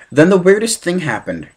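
ordinary delivery, nothing marked

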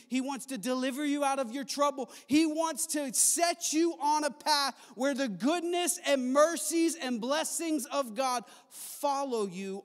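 preaching climax. Acted. Preaching climax over music.

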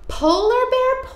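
first Polar Bear sung high; high call. Shown as a demonstration. Sing-song call, high then low.

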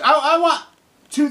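high loud stammering burst; shouting. Real, not acted. Speaker to future generations, beginning an outcry, shouting. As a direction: burst out, then catch yourself.

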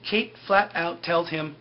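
ordinary talk

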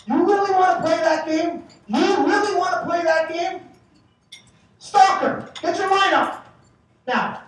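high, loud furious coach yelling at bench. Retold or imitated. Angry coach yelling at the rival bench. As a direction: bark it across at the other bench. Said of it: "Muckler yells out".

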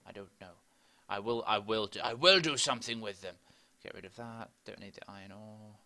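normal talking voice

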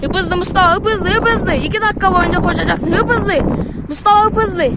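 cheering him on; excited urging. Real, not acted. Urging friend to run.

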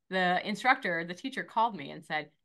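usual speaking voice

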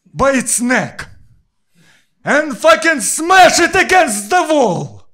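slow, high, building to a loud crazed yell; old grandpa story. Pretend. Old grandpa telling his grandkids a war story, slow high voice building to a yell. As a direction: drag it out like a deranged old man, then explode. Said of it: "eating the potatoes and say it".

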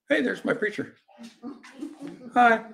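high, mock-cheerful greeting; blithe onlooker. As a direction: spot someone familiar and greet them brightly. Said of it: "do we sit blithely by going".

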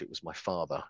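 ordinary talk

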